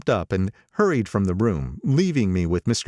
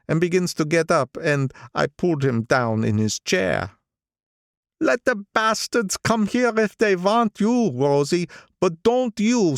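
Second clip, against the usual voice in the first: put-on old gangster voice; 13 semitones higher than usual. Old gangster recalling a friend's murder, higher gloomy voice. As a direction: tell it like a grim memory, pitched up. Wolfsheim tells the shooting story.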